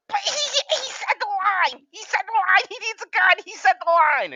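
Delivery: put-on telling his girlfriend, overexcited squealing; excited squeal. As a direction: squeal like a geeked-out fan in the theater. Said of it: "I go".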